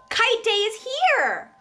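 high, eager announcement; thrilled kite day cry; excited. A narrator's reading. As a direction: burst with excitement.

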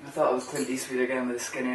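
ordinary talk

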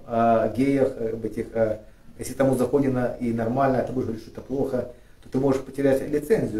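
ordinary delivery

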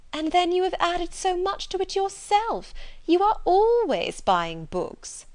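high, fawning society lady; gushing flattery. Acted. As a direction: lay on the admiration, stretching the emphasis. Miss Bingley flatters Darcy in story.

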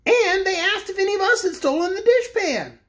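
high indignant complaint. A narrator's reading. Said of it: "continued Corporal Waddle indignantly".

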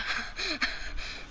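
a soft breathy giggle. Real, not acted. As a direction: giggle after the teasing. Laughs at own joke.

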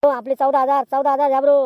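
loud, high shouted bid; calling out a bid. Acted. Bidder to Jhabru offering fourteen thousand, loud call. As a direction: throw the bid out over the crowd.

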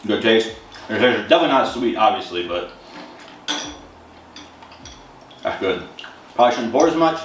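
talking between bites; chewing while talking. Real, not acted. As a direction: mouth partly full of cereal. Seen 1: Spoon bite at -4; lips pursed, jaw working while talking over bowl.

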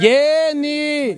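high, long-held name call. Real, not acted. Calls a blindfolded player. (llamando con voz aguda y sostenida)

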